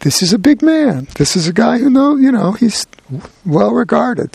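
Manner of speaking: lightly voiced custodian, marveling at the big man; impressed custodian. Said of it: "And he says".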